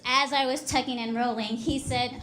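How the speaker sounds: usual speaking voice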